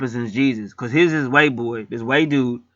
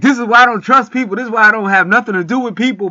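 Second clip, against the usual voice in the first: loud, high bitter rant; imitating a furious man, loud and raised; angry outburst; 9 semitones higher, 8 dB louder than usual. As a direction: storm out shouting it. Derek storming out after fight.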